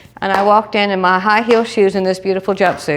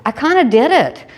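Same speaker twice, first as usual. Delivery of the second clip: high sheepish excuse; 7 semitones higher than usual. Retold or imitated. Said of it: "And I said".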